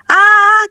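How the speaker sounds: high-pitched startled "ah"; frightened cry. A narrator's reading. Player jumps at the scary lady, frightened cry. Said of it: "entre risas y gritos".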